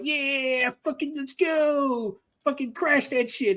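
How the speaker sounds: mock villains hyped up, stretching the words out; excited bad guys. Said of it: "They're all excited".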